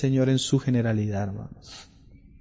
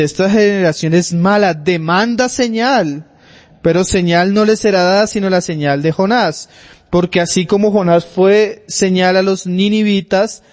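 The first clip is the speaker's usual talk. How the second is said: loud, high scripture proclamation; preaching at full force; 5 semitones higher, 5 dB louder than usual. Acted. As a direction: thunder the verse to the congregation.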